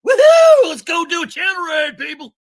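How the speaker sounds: whooping high and loud rally cry; rally the crowd with a big whoop; excited whoop. Retold or imitated. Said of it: "I'm like".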